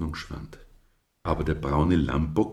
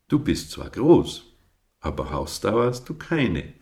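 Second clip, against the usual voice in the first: teasing; 4 semitones higher, 5 dB louder than usual. A narrator's reading. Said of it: "neckte er".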